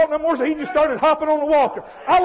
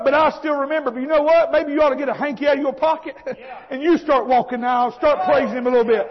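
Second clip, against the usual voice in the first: usual speaking voice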